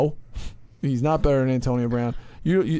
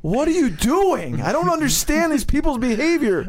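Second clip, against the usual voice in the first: mock baffled rant; 11 semitones higher than usual. Mocking a reckless rich football player.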